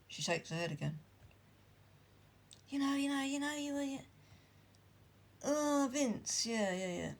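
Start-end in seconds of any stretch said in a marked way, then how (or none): 2.7-7.1 put-on Essex accent. A narrator's reading.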